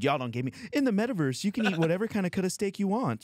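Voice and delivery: high, fast, indignant squeal; ranting at the waiter in a high voice; mock outraged rant. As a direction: climb way up in outrage. Ranting at restaurant, voice rises.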